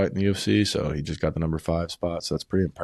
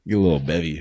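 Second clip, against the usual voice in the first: louder, relishing the words; eager call for a drink; 5 dB louder, faster than usual. Real, not acted. Savoring a Wendy's drink.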